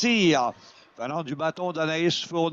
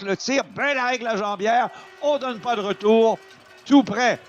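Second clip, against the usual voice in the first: excited; 7 semitones higher, 5 dB louder than usual. Real, not acted. Goal-mouth chance in hockey commentary.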